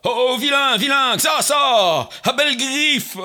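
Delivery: loud, brash devil calling at the door; devil crying out. Acted. The devil shouts for the farmer at his door, loud. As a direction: bang on the door and bellow it. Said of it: "s'écria".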